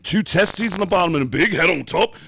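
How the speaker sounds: high, loud put-on voice for the rock singer. Retelling how the singer greets the naked band, in a high loud voice. [name] voiced in retold story.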